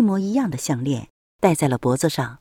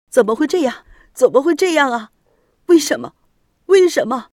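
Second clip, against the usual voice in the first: high, loud cry of disbelief; anguished cry; 8 semitones higher, 4 dB louder than usual. Acted. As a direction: cry out in horror. Husband sees wife stabbed.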